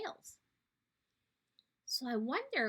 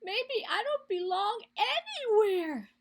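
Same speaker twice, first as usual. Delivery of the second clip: high, louder sad little creature; crying platypus; 7 semitones higher, 5 dB louder than usual. Acted. Sad platypus tells the animals, tearfully high. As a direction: whimper it like a sad baby animal. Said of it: "began to cry".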